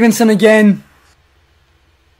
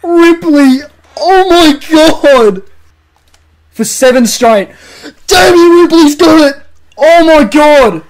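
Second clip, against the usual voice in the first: higher, louder, slow "Oh my God"; ecstatic goal call; 5 semitones higher, 3 dB louder than usual. Acted. Commentating the seventh straight goal, ecstatic. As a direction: lose it on the call.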